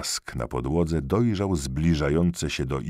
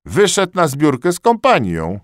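very high, loud reply; high answer; 12 semitones higher, 9 dB louder than usual. A narrator's reading. A soldier answering the duty soldier, high and loud. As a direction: pipe up with the answer.

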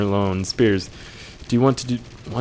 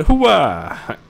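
battle cry; 9 semitones higher, 9 dB louder than usual. Pretend. Patriotic rallying cry.

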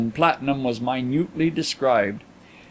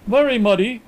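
higher, lightly acted generous interjection; 7 semitones higher, 4 dB louder than usual. A narrator's reading. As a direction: concede it generously in a raised pitch. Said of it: "interposed Mister Carlyle generously".

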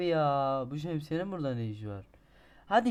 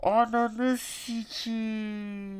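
slow, stretched, higher furious swear; slow stretched angry curse; drawn-out curse; 7 semitones higher, 5 dB louder, much slower than usual. Real, not acted. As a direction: drag the curse out in rage. Drawn-out curse after fall.

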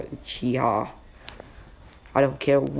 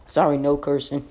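usual speaking voice

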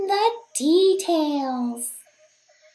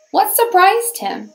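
plain reading voice

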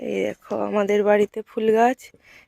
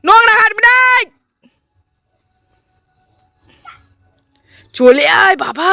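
high, loud call across the yard; 12 semitones higher, 11 dB louder than usual. Real, not acted. Calling a child to come away.

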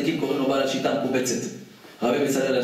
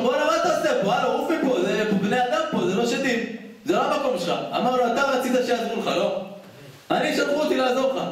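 high, lively mock back-and-forth; rabbi and demon bickering; 9 semitones higher than usual. Rabbi shooing a little demon off the mast, high and cheeky. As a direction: throw the lines up high like a cheeky street quarrel.

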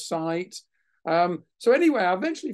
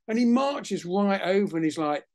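ordinary delivery

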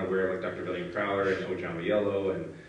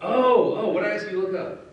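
laughing; 14 semitones higher, 8 dB louder than usual. Real, not acted. Confused, laughing at friend's prompt.

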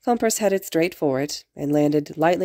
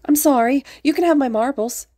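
high, louder mock panic; fake alarm; 9 semitones higher, 4 dB louder than usual. A narrator's reading. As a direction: plead with pretend fright. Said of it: "Izuku gave a fake gasp".

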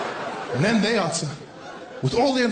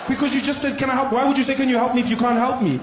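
exasperated caller; 5 semitones higher, faster than usual. Acted. Caller argues with agent.

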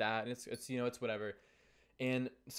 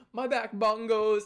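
loud, slow, higher mock announcement; goofy boast; 7 semitones higher, 9 dB louder, slower than usual. Imitating Zach clowning for cabinmates, goofy loud boast. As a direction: imitate a boy showing off, loudly. Imitating boy slapping his butt.

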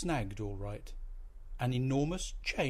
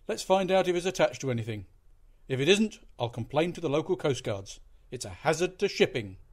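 commanding; 4 semitones higher, 6 dB louder than usual. A narrator's reading. Captain giving orders.

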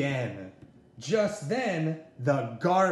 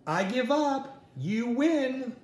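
calling out; 8 semitones higher than usual. Acted. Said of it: "yelled Buzz".

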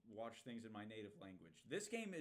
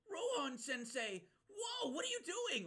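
loud high mock cry at Rohan Sensei; mocking Koichi; 8 dB louder, slower than usual. Streamer imitating Koichi calling Rohan, mock high cry. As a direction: squeal it like an overeager anime kid. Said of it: "he's like".

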